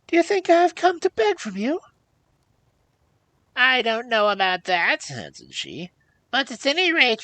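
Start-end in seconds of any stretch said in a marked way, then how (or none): 0.1-1.8 very high, loud protest; affronted, voice pitched way up; indignant princess. A narrator's reading. Princess to the old woman, indignant cry. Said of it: "cried the princess". 3.6-5.1 high, loud crone voice; sceptical old hag. A narrator's reading. Old hag doubting the princess, sceptical.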